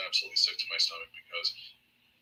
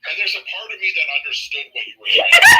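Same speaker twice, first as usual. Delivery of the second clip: much higher, louder laughing exclaim; laughing shout; 11 semitones higher, 13 dB louder than usual. Real, not acted. Cohost laughing loudly about the confusing story, high shout. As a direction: shout it while laughing hard.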